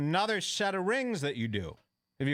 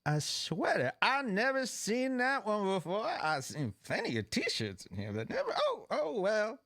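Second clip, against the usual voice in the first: disapproving judge; 6 semitones higher than usual. Retold or imitated. Judge frowns on the couple's casual sweater, sniffy and high. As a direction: voice jumps much higher, sniffy and disappointed. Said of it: "And she goes".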